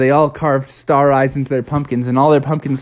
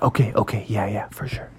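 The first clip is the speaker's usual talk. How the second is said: hushed whispered promise; 5 semitones lower, 6 dB quieter than usual. Acted. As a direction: whisper it so the grown-ups don't hear. Pumpkins told to keep quiet.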